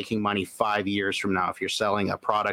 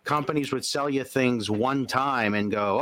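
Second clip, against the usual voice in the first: normal talking voice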